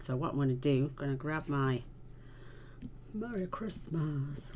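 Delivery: normal talking voice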